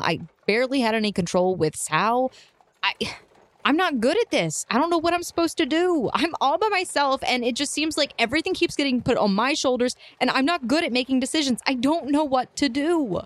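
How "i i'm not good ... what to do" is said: higher-pitched distressed outpouring; overwhelmed. Acted. Guild leader admitting she can't cope, overwhelmed. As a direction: plead helplessly, voice lifted.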